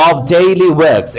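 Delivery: slow linked daily works, r dropped. Shown as a demonstration. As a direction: say "of daily works" slowly, gliding the words together. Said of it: "is the combination". (ধীরে টেনে "of daily works" জুড়ে বলা)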